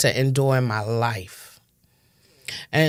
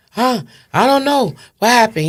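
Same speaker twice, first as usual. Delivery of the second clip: playing dumb; 9 semitones higher, 6 dB louder than usual. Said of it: "He acting crazy now".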